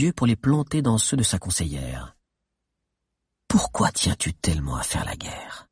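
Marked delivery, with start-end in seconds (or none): 3.5-5.7 low, whispery question; hushed probing. Acted. Chief questioning his adviser's real motive, hushed. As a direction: ask it low, almost in a whisper. Akan probes her, voice dropped.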